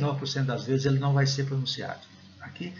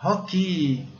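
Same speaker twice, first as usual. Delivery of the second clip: louder, higher "Hockey" with breathy H; Silvio Santos; 5 semitones higher, 4 dB louder than usual. Shown as a demonstration. Teacher compares the H to a TV host, showman imitation. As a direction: punch out "Hockey" like a showman's laugh syllable. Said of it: "mais ou menos igual o Silvio Santos fala".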